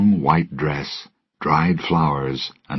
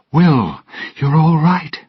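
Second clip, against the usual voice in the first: higher and louder, bursting with relief; relieved joy; 12 semitones higher, 5 dB louder than usual. Acted. Elizabeth rushing to Will, crying out with relief. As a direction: cry out his name in relief.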